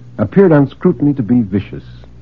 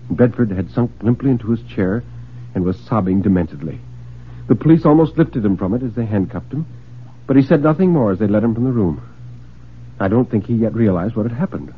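plain reading voice

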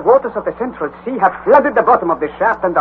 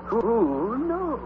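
much softer, trailing off; softer musing echo; 4 semitones higher, 10 dB quieter than usual. Acted. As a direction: let it fade away. Repeated musing.

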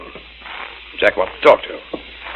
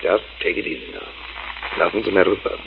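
hushed; 6 semitones lower, 10 dB quieter than usual. Acted. Calming scared grandmother.

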